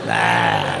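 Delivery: a flat deep "ah" grunt; low grunt noise. Retold or imitated. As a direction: make a caveman noise. Imitated noise of the man.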